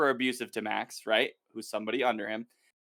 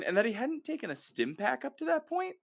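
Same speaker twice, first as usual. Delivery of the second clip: ordinary delivery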